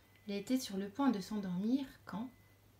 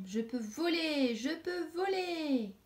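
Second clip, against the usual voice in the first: slow, rising wonder, higher on the second; joyful discovery; 7 semitones higher, slower than usual. Acted. The young butterfly marvels at flying, slow and joyful. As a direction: savour each word in delight.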